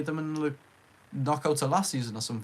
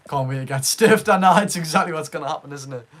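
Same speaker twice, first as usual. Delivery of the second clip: loud mock complaint; 2 semitones higher, 9 dB louder than usual. Real, not acted. Sarcastic loud joke about being stiffed.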